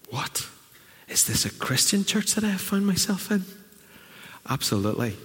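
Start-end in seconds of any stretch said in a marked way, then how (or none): 0.1-3.4 high, incredulous question; hammed-up disbelief; mock shock. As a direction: play the scandalised churchgoer. Imagined shocked listener.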